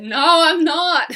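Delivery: playful no while shaking the head; mock denial. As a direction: protest it like an embarrassed viewer. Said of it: "Just shake your head".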